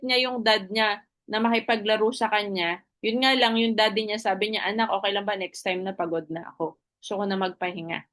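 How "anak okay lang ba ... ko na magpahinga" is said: worn-out father, quieter and trailing, fast at the end; tired dad. Acted. A tired father telling his son he needs rest, drained and soft. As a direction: sigh it out like a dad who is done for the day.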